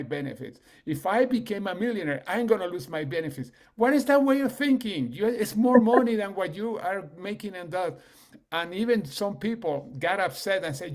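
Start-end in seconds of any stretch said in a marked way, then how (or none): 3.8-8.0 exasperated. Real, not acted. Exasperated at benefits reasoning.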